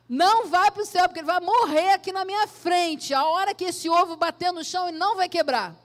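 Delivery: fervent prayer building to a loud, high cry; praying out loud. Acted. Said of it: "começou a orar em voz alta". (oração em voz alta, crescendo até quase gritar)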